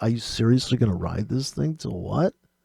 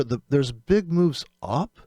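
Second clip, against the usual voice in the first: ordinary delivery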